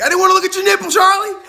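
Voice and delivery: high, loud mock complaint; squawked imitation of a grossed-out viewer; mock viewer outcry. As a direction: holler it in pretend protest.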